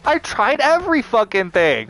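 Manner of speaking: usual speaking voice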